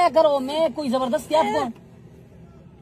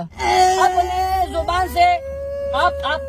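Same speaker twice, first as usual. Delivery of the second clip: mock sobbing; 7 semitones higher, much slower than usual. Pleading him to speak, fake sobbing.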